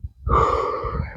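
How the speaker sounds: long breathy sigh; calming exhale as a demo; demonstrated breath. Shown as a demonstration. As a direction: let out a slow, audible breath.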